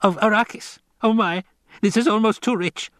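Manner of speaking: high-pitched, gleeful tenor; acted amusement. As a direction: crow with mirth at a delicious joke. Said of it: "Piter began to laugh".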